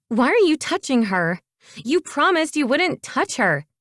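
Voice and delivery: put-on high, swooping, upset girl's voice; distraught jealous protest; distressed. A narrator's reading. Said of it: "his girlfriend's distress".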